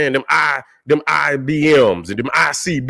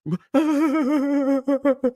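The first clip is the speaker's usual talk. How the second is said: high mocking laugh; 12 semitones higher, 4 dB quieter than usual. Real, not acted. Laughs at critic.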